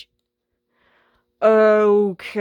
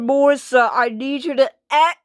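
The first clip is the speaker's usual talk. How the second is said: ordinary talk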